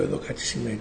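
a hushed, awed murmur; hushed realization. Retold or imitated. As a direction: say it softly as it dawns on you. Finds second unexplained freezer package.

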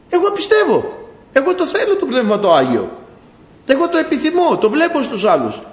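high-pitched, exasperated complaint; retold angry protest. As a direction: re-enact arguing indignantly with God. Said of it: "Θύμωσα με το Θεό".

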